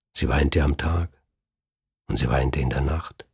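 hushed, voiceless narration; whispered narration. A narrator's reading. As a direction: breathe the line out as a whisper. Erzählerin flüstert vom Weinen.

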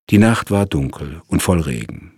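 ordinary delivery

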